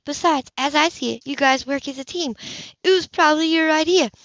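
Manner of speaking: put-on a kid wriggling out of blame; boy's voice. A narrator's reading. Seth's boyish voice.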